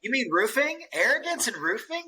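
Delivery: high-pitched feigned disbelief; playfully aghast question; mock incredulous. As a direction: act scandalized, pitched well up. Teasingly feigns shock at the point.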